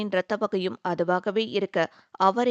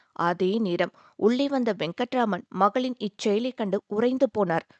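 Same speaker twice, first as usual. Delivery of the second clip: plain reading voice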